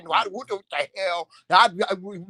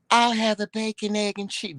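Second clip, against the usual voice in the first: lightly higher dainty order voice; mimicking husband; 3 semitones higher than usual. Wife mocks husband's coffee-shop order in a dainty voice. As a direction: imitate his sweet little order. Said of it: "I'm doing the expressions and everything".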